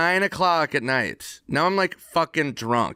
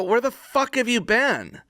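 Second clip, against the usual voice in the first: exasperated demand; 10 semitones higher than usual. Retold or imitated. Retelling confronting Norm again.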